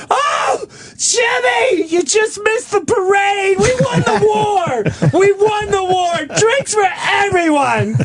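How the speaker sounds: high-pitched, wildly hollered celebration; frantic screeching announcement, hammed-up; mock demented shouting. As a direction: holler it high like a delirious old mother. Acting out mother's bonkers war outburst.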